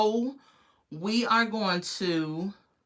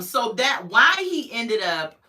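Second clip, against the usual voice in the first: normal talking voice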